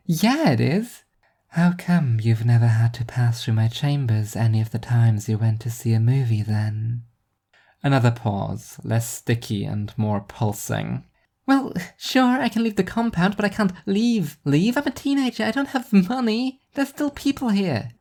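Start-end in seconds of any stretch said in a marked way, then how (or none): 0.1-1.0 put-on a teenager insisting, pitched up; high teen girl retort. 1.5-7.0 hushed, unvoiced questioning, quickening mid-line; whispered eerie girl. Eerie child questions Nadine. 11.5-17.9 Nadine pitched way up, defensive; a teenager explaining why she can't leave; bratty, high, self-justifying teen; high teen girl voice. Acted.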